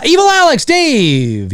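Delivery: high, booming call-out; drawn-out shout-out to a viewer. Pretend. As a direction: call the name out high, drawing out the last word. Calls out a chat name.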